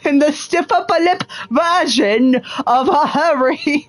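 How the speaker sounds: can barely get the words out for laughing. Real, not acted. Gamer cracking up at his own Watson voice, laughing.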